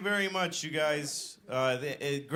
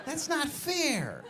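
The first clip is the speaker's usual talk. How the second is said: slow, drawn-out mock whine; hammed-up put-upon moan, rising high; whining protest; 5 semitones higher, much slower than usual. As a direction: complain like it's terribly unfair. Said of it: "I'm like".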